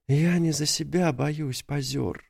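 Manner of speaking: teary. A narrator's reading. Near tears alone.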